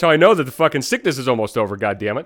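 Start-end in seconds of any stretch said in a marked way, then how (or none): none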